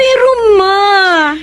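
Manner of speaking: crying out, slow and broken; pleading sob. Acted. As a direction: beg her mother through tears. Daughter pleads, forced marriage. (umiiyak na pakiusap)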